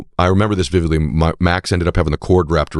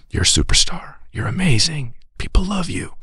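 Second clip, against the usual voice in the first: tender note; 12 semitones higher than usual. Retold or imitated. Father's written note to daughter, tender. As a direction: read it like a love note.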